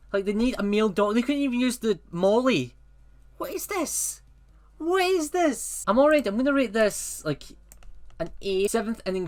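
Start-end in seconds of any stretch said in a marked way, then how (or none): 3.4-5.8 high-pitched baffled outburst; incredulous. Real, not acted. Reviewer reacting to a movie premise, incredulous. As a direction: ask it in high disbelief.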